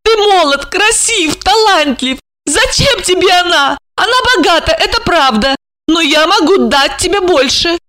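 high-pitched impassioned woman; high, overwrought wooing; urgent persuading. A narrator's reading. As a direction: press the lover with high, insistent pleading. Marina urgently woos and argues.

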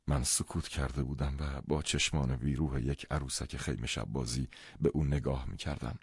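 usual speaking voice

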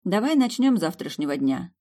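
ordinary delivery

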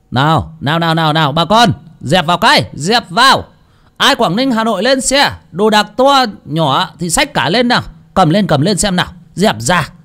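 herd passengers onto the bus; bus conductor. Acted. Conductor calling passengers to board for Hà Nội, hollering. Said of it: "cái giọng ông lơ xe quen thuộc".

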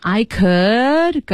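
slow, suspended half-sentence; halting, stopped midway. Acted. Marty holding back where he wants to go, halting. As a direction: drag it out and leave it dangling. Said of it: "停了半截儿".

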